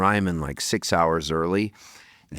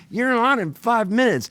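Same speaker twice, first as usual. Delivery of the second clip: urgent call; 13 semitones higher, 4 dB louder than usual. Retold or imitated. Stagehand finds him, urgent.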